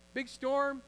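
normal talking voice

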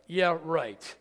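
dry muttered yeah right, doubting; sarcastic under breath. Retold or imitated. Congregant mocking the verse under breath, sarcastic scoff. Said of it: "hear them saying under their breath".

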